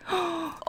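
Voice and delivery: high, soft excited gasp; sharp intake of delight. Real, not acted. Vlogger thrilled by the mushroom plant, happy gasp.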